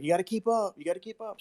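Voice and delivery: imitated urging. Dad retelling son urging him on skis, high mock urging. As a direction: push them along in a light, high voice. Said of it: "He's like".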